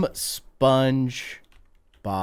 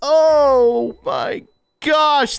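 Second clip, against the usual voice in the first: drawn-out shock; 12 semitones higher, 4 dB louder, much slower than usual. Real, not acted. Horrified by the AI image.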